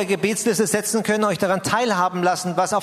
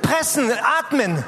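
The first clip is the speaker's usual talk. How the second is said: mild mock yell; 4 semitones higher than usual. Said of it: "Und er hat gesagt".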